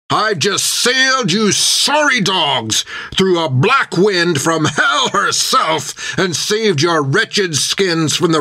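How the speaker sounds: high, drawn-out captain's tirade; Creech shouting. A narrator's reading. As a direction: berate the crew as a furious captain. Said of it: "he shouted".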